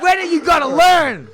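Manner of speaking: high, loud scolding; mock exasperated vet. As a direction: scold him like a fed-up vet.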